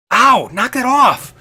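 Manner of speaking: loud, high yelp of complaint; pained protest. Acted. Hurt character to the one hurting him, loud pained protest.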